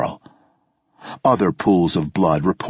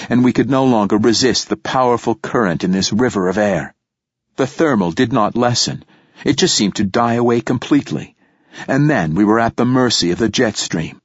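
ordinary delivery, nothing marked

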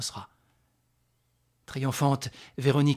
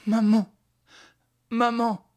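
put-on high, loud child's cry; boy calling mother; 9 semitones higher, 7 dB louder, slower than usual. Rescued son clings to his mother, crying out. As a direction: call out to her in a high voice.

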